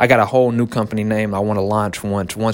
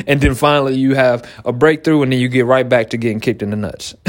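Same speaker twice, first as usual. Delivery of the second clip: usual speaking voice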